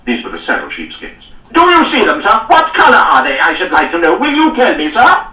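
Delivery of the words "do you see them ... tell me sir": high, loud furious bellowing; enraged lord berating a captain; raging. A narrator's reading. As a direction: tear into him with outrage. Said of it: "Cardigan raged on".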